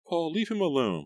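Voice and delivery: high, loud plea; crying out. A narrator's reading. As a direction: cry out to stop him. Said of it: "Melissa cried".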